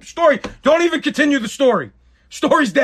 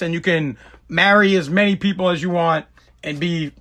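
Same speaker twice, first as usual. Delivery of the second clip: normal talking voice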